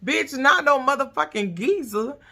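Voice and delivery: answering the TV in disbelief; indignant retort. Retold or imitated. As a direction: push back, outraged. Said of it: "I was like".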